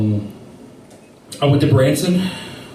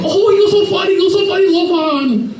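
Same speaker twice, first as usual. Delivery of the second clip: mock laughing crowd; 14 semitones higher than usual. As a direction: gush it up high like the crowd. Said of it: "everyone starts laughing".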